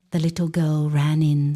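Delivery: plain reading voice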